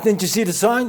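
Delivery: the guard scolds the rule-breaker; scolding guard. Acted. A pool guard scolds the swimmer he pulled out, annoyed.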